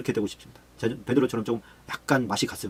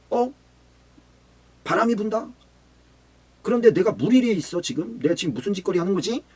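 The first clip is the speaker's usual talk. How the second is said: panicked doubt; 7 semitones higher than usual. Acted.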